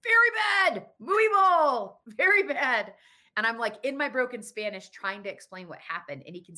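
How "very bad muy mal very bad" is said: high, slow, loud wail of very bad; stretched loud despairing muy mal; desperate plea. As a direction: cry out your despair to the janitor. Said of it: "And I was like".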